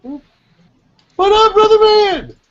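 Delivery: high, slow, loud bro greeting; drawn-out hype greeting. Acted. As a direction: stretch it out like greeting your best bro. Jokey greeting to old friend.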